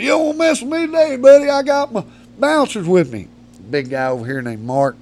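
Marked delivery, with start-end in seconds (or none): 0.0-3.3 put-on high, loud, cocky drunk taunt; a swaggering drunk picking a fight, pitched up; drunk bully taunt. Drunk Stanley threatening the teenage narrator, high cocky taunt. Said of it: "he goes".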